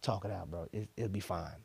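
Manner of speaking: low, very soft reassurance; imitated easygoing cop, hushed and low; calming officer. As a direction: quietly talk someone down. Retold cool officer calming him.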